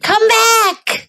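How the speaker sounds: high-pitched story call. A narrator's reading. Old woman calls the runaway back, very high cry. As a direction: call after him in a high voice. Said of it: "cried the little old woman".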